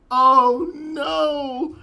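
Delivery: loud, high, drawn-out oh no; hammed-up dread as it dawns on you; dramatic dismay. Reenacts own dread at the twist.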